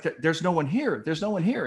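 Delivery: retold flustered helplessness; mock panic. Running around, finding no reporter.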